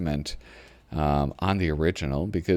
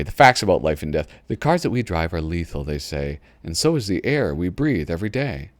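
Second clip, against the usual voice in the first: normal talking voice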